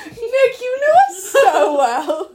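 high, loud, laughing slowly; laughing gush. Real, not acted. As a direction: gush while cracking up.

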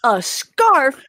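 amazed that the prize is a scarf; astonished at the scarf prize; surprised. Real, not acted. Disbelief at the race prize.

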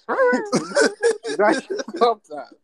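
high, slow, laughing through it. Shown as a demonstration. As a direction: stretch it out while cracking up. Repeats compliment, then laughs it.

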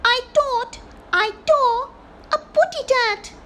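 high, loud little bird voice; squeaky cartoon bird lisp; Tweety voice. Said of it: "Tweety said".

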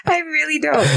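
high-pitched laugh through the words. Real, not acted. Wife admits to husband she has no compassion, laughing. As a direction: laugh helplessly while confessing.

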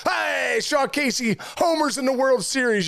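high, shouted ballpark announcer call; mock play-by-play announcer. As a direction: call the homer like a kid playing announcer. Kid imagining a home-run call.

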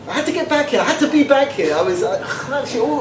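usual speaking voice

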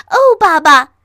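put-on high, very loud child's shout; loud excited cry. A narrator's reading. Little fox calling dad to see, loudly excited. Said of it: "他大声说".

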